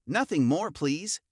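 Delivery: plain reading voice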